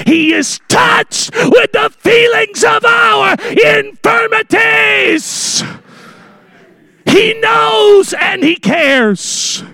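high, drawn-out preacher's shout; full-force preaching. Real, not acted. Preacher answering doubts that Jesus knows, impassioned shouted preaching. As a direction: cry it out to the congregation at the top of your range.

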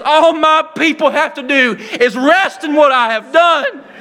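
full-force preaching with shouts. Acted. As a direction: preach it out to the congregation, building.

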